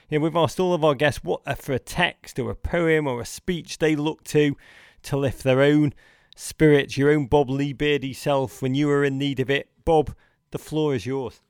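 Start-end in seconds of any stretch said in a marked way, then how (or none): none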